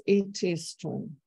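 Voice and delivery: It is strong, slow, each word stressed, falling tune. Shown as a demonstration. Lecturer demonstrating the pattern for the class. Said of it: "just pay attention to this".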